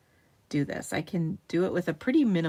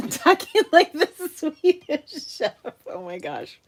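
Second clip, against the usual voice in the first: laughing; 10 semitones higher, 6 dB louder than usual. Real, not acted. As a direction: squeal it through laughter. Laughing at her own voice.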